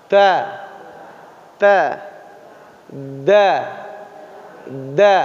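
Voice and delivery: ta and da repeated with pauses for echoing; letter drill. Shown as a demonstration. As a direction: model each letter and pause for the class.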